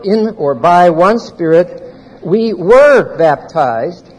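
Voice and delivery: slow, weighted reading with "were" lifted; corrected reading. Shown as a demonstration. Teacher reading the corrected verse aloud to the class, slow and weighted. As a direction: read it slowly, leaning on "were". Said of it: "you can change the King James".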